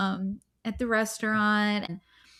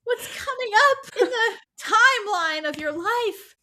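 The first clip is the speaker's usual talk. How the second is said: animated; 6 semitones higher, 5 dB louder than usual. Real, not acted. Exasperated realisation telling friend.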